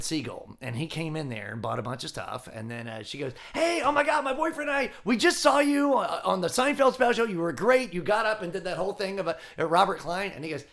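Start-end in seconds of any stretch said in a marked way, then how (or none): 3.5-10.3 put-on high, loud gushing shop girl; excited fan. Girlfriend meeting Garry Shandling, high excited gush. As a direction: gush like a starstruck clerk.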